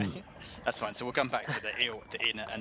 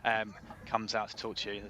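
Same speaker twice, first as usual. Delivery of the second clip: ordinary delivery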